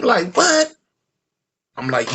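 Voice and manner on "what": high startled what; shocked what. Retold or imitated. Friend hearing the plot, a high shocked what. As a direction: disbelief pops out high.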